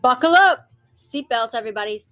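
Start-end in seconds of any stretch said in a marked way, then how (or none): 0.0-0.7 louder, higher buckle up; loud warning. Real, not acted. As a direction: call it out like a ride warning. Warning the listeners.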